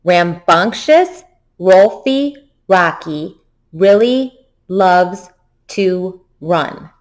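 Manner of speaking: very slow, drawn-out twister; slow choral reading; really slowly. Shown as a demonstration. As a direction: stretch every word out for the class. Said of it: "we'll go really slowly together".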